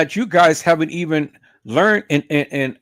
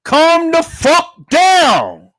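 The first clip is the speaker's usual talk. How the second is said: much higher, louder, very slow yell; mock furious yell; 9 semitones higher, 7 dB louder, much slower than usual. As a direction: drag it out like a fed-up woman snapping at him. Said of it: "She'll look at him and say".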